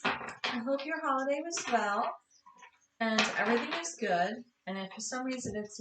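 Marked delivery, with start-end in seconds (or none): none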